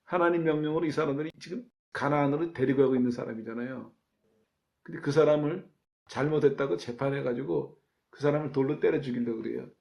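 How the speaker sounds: ordinary delivery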